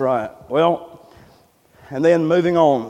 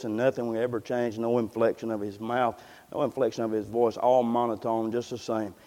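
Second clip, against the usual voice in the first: low, soft, flat-tuned demo of Edwards' reading; monotone reading; 6 semitones lower, 10 dB quieter than usual. Shown as a demonstration. Preacher showing how Edwards read his sermon, low soft monotone. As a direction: drone it with no inflection, quieter and lower. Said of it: "read in a voice just like this".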